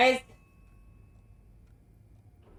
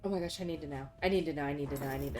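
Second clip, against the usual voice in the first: low, soft urgent repeating; anxious murmur; 11 semitones lower, 14 dB quieter, much faster than usual. Real, not acted. Player desperate for answers, low soft urgent murmur. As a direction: whisper your need to know.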